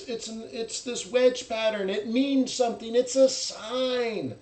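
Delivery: mock awed believer. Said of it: "You ever watch, uh, The Life of Brian".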